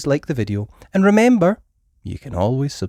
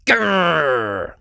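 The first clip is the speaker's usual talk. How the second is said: dinosaur growl; 5 semitones higher, 4 dB louder than usual. A narrator's reading. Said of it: "shouted George".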